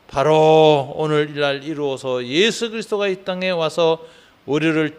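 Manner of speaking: loud, sustained pastoral prayer delivery; fervent public prayer. Real, not acted. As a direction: lift the congregation's prayer up forcefully in a steady, level chant. Leads the closing prayer. (힘주어 외치는 통성 기도)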